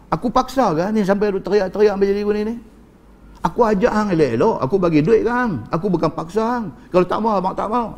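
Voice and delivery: high-pitched, insistent self-defence; indignant protest. Acted. Man defending himself to the crying woman, indignant protest. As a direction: protest loudly that you are blameless.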